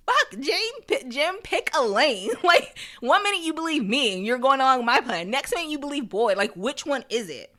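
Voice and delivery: high, loud retold rant; exasperated outburst, half amused in the retelling; mock exasperation. Randall losing patience with Jim, mock exasperated.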